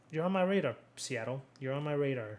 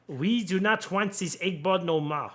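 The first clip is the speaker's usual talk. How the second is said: higher, louder mock German accent; badly faked German accent, raised in pitch and volume; horrible German accent; 5 semitones higher, 6 dB louder than usual. Podcaster voices Germans fed up with football, bad German accent. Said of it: "that was a horrible German accent".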